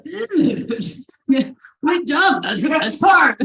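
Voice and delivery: high, loud, gung-ho dude voice; imitating an excited teenage dude; excited friend. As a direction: hype them up to jump, loudly. Friend eagerly urging the jump.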